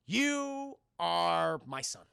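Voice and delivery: very slow, solemn tender line; mock heartfelt Batman. Batman comforting Robin, very slow and solemn. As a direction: linger on it, overly sincere.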